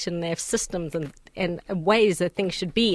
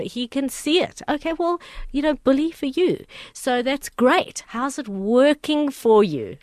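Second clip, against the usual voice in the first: high, slow mock praise; sarcastic; 7 semitones higher than usual. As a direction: drip sarcasm, slowly. Sarcastic retort to know-it-all.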